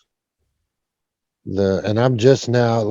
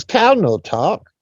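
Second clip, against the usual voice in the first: clipped broken English; 8 semitones higher, slower than usual. Retold or imitated. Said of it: "And he says".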